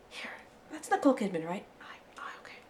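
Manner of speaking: hushed aside, trailing into a whisper; cinema whisper. Retold or imitated. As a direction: check quietly with your seatmate, then whisper back. Whispering questions during a film.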